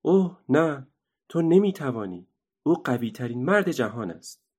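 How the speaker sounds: put-on a small girl gasping oh no; Annika, alarmed. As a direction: plead with her not to try. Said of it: "آنیکا گفت".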